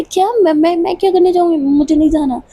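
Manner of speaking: high, stumbling protest; narrated alarm, pitch leaping up; startled refusal. A narrator's reading. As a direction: refuse in flustered surprise. Told she is being kidnapped.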